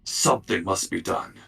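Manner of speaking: low, deep raspy talking cat. Acted. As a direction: speak low and raspy, like a mysterious cat messenger. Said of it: "like a deep, raspy voice".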